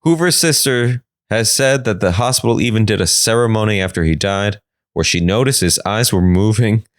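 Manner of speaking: ordinary talk, nothing marked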